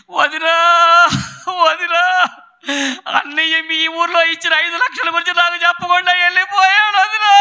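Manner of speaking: hammed-up sing-song lament, rising to a cry; imitated funeral wailing. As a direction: imitate a mourner keening out a complaint. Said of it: "ఎందుకు ఎడుతుంది?".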